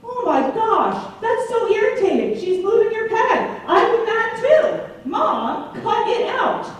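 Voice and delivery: high, sympathetic mock indignation with laughs; high laughing take-his-side voice; mock outraged support. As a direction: side with him, playfully scolding mom. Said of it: "I say".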